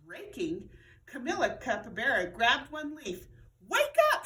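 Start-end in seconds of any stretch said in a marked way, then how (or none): 3.6-4.2 high, loud yell. A narrator's reading. Camilla yells at a leaf to wake up. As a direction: shout it at the leaf. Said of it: "she yelled".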